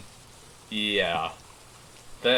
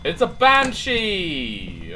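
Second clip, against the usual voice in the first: slow, loud excited reveal; 8 semitones higher, 8 dB louder, much slower than usual. Real, not acted. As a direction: drag out the reveal, thrilled. Announces ghost in drawn voice.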